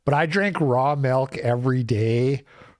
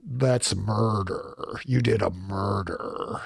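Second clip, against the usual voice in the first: low, slow, soft mock accusation; slow, deep mock-solemn verdict; mimicking the clip; 6 semitones lower, 5 dB quieter than usual. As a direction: draw it out low and hushed, imitating him. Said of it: "I was just hearing him at the end there".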